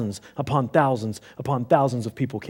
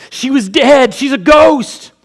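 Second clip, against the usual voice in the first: much higher and louder terrified yell; panicked shout; 14 semitones higher, 15 dB louder, slower than usual. Acted. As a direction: scream it out in alarm. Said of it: "People began to shout".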